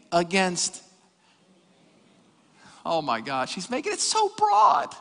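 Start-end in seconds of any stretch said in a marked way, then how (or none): 2.8-4.9 mock dismay. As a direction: whine it higher and higher as a scandalized listener. Acts out listener's dismayed complaint.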